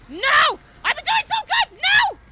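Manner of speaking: high, loud wail of protest; shrieking no; anguished screams. Real, not acted. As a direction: scream your frustration at getting caught. Caught after playing well.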